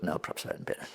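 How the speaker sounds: hushed inner no, no, no; under-the-breath hesitation; whispered thought. Retold or imitated. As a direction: whisper it to yourself, backing off. Inner thought, deciding against helping.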